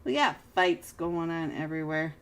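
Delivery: normal talking voice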